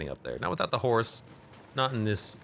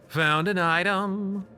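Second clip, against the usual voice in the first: higher, slow sing-song announcement; game announcer voice; 6 semitones higher, slower than usual. Pretend.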